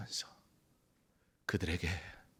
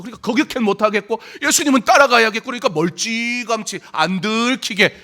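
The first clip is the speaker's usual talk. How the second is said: high, loud, impassioned preaching; fiery preaching; 12 semitones higher, 12 dB louder than usual. Acted. Preacher drives home Peter's fear to the congregation, loud and impassioned. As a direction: pound it out from the pulpit.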